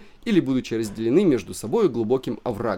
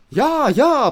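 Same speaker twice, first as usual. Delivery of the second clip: shrill angry yes-yes, high and loud; spiteful yelp; 14 semitones higher, 8 dB louder than usual. A narrator's reading. Spiteful German wife yelps at the visitors. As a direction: snap it out spitefully. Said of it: "подхватила злобная немка".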